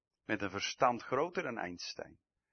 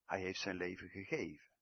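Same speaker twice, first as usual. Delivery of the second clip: usual speaking voice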